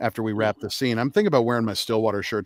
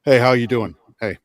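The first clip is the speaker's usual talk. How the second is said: gruff, severe greeting; 7 dB louder than usual. Retold or imitated. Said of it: "this very gruff, s-, very severe".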